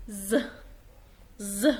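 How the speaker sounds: "ز" as a short, isolated buzzing Z sound. Shown as a demonstration. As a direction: voice the letter S as "ز". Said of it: "بعده حرف متحرك ينطق". (نطق حرف S زي صوت "ز")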